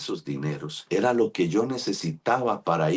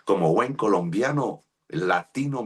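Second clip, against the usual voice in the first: ordinary talk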